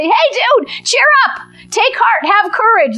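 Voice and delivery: high, bright hammed-up cheering on; rally him with sudden eagerness; eager encouragement. Crowd urging Bartimaeus to go to Jesus, high eager call. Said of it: "and they say".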